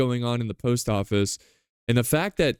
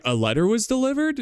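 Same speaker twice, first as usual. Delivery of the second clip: surprised crowd; 11 semitones higher, faster than usual. Retold or imitated. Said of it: "People are like".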